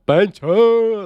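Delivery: excited exclamation. Retold or imitated. Retold excited reaction.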